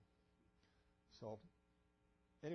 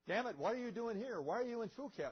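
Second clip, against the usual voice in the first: angry colonel dressing someone down, pitched high; retold yell, high and indignant; imitated screaming colonel; 9 semitones higher, 3 dB louder than usual. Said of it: "was screaming at me".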